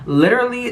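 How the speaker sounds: indignant stress on "literally"; emphatic literally. Real, not acted. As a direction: punch "literally" high and hard. Stressing how often it happened.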